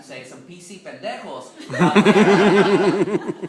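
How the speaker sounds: laughing, loud and slow, at his own cuss word. Real, not acted. Apologizing for cussing to the crowd, laughing. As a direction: relish the naughty word while laughing.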